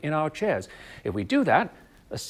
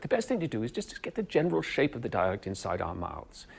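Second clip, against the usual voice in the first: normal talking voice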